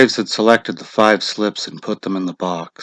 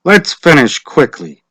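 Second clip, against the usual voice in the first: official voice; 4 semitones higher, 6 dB louder than usual. Acted. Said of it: "Mister Summers said".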